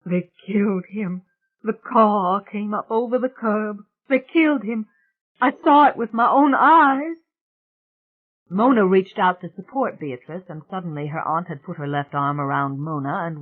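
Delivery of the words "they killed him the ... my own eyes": high, distraught, breathless outburst; gasping for breath. A narrator's reading. Bea tells Rowan of the killing, gasping. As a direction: build to a loud, anguished cry. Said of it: "gasping for breath".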